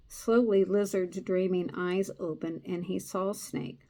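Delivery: steady storytelling read; story narration. A narrator's reading. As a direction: read the tale to a listener.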